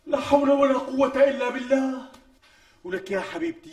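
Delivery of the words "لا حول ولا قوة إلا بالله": exasperated outcry. Acted. Husband exasperated by demand.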